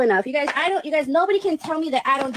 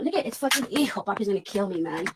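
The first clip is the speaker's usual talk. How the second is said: ordinary talk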